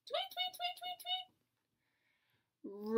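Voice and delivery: tiny, high, soft tweeting; high bird tweets. Pretend. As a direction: chirp like a little bird. Imitating a bird.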